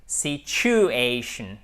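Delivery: Situation very slow, weight on the a of ation; stressed situation. Shown as a demonstration. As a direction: draw out Situation, hit the a. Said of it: "votre accent tonique qui est là".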